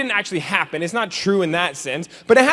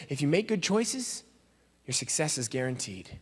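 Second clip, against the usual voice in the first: quiet slogan; 3 semitones lower, 11 dB quieter than usual. Shown as a demonstration. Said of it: "slogan first said softly".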